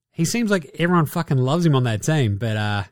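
usual speaking voice

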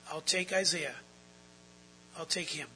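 normal talking voice

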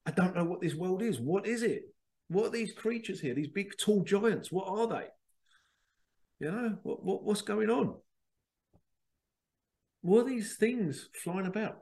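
high, wide-eyed crab voice, lost in a strange world; bewildered crab. Pretend. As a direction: play a baffled little crab gazing up at giants.

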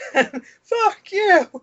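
high, strained mock yell; mock angry neighbor. Neighbor yells back at the cheery prince, mock rage. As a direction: snap back at the stranger, hammed-up. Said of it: "he's like".

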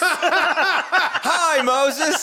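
high, bright chorus reply; mock support group greeting. Mocking AA meeting, high laughing group greeting. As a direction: chime in like a recovery group.

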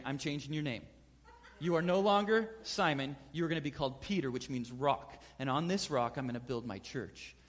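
low, soft, solemn pronouncement; hushed solemn declaration; solemn Jesus. Retold or imitated. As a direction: speak it like a quiet blessing. Jesus renames Peter solemnly.

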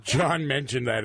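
usual speaking voice

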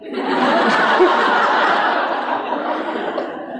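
a silent, delighted laugh; laughing at her own secret; breathy laugh. Real, not acted.

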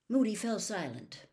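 usual speaking voice

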